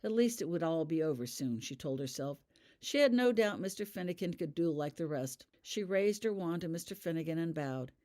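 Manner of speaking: ordinary delivery, nothing marked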